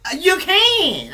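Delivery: slow, soaring exclamation; excited shout. Real, not acted. Hyping up the viewer.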